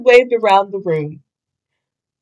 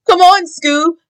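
shouting; 8 semitones higher than usual. Acted. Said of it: "shouted Shaggy".

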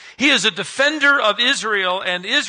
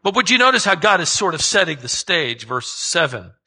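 ordinary delivery

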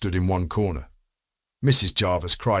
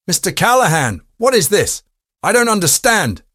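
high, loud, startled woman's voice; alarmed protest; 11 semitones higher, 6 dB louder than usual. Acted. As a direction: demand an explanation in shocked disbelief. Startled suspect protests her innocence.

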